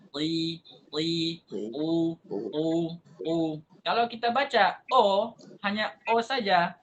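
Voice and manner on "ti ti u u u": ti and u said slowly one by one; vowel drill. Shown as a demonstration. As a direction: model each vowel clearly. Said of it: "suara ta itu berbeda".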